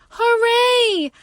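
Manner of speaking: high, loud hooray; joyful shout. Acted. Mother Rabbit cheering her bunny's rescue. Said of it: "Mother Rabbit shouts".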